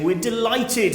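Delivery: a touch of mock delight; imitated delight. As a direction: lightly act out a delighted parent, pitch lifted. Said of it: "posted comments and sent me messages saying".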